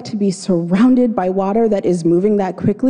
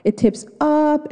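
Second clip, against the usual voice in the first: rising suspense; 8 semitones higher than usual. Acted. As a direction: draw it out high with suspense. Suspense build on the rapid.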